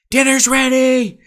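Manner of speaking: high, drawn-out shout imitating aunt; top-of-lungs yell. Aunt calls family in to dinner, mock yell. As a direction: mimic an aunt yelling at full pitch. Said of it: "shouted at the top of her lungs".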